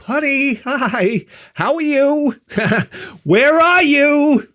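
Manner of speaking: high singsong honey voice, nervous giggle; forced sweetness. Retold or imitated. Husband phoning missing wife, forced sweetness. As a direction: coo it, drawn out, with a fake laugh.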